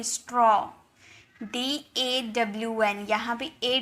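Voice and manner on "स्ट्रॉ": स्ट्रॉ with rounded "aw": straw; correct "aw" sound. Shown as a demonstration. Teacher corrects the mistake, स्ट्रॉ with rounded aw. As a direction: say स्ट्रॉ to rhyme with "law". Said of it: "स्ट्रॉ नहीं".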